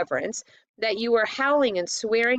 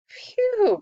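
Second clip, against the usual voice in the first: sharp high gasp of disgust; gasped phew; 3 dB louder than usual. A narrator's reading. Eustace recoils from the foul smell, high gasp. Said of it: "gasped Eustace".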